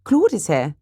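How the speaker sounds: eager, lifted outburst; delighted cry. Acted. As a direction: cry out in happy surprise. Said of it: "cried Elizabeth".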